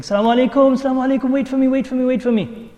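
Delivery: high, urgent calling after someone. Retold or imitated. Shaykh calls to his travelling students, urgent hail. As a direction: call out on a sustained high pitch.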